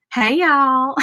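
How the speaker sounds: Southern accent. Shown as a demonstration. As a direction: play up the Southern accent a little. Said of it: "the Southern accent. I always love it".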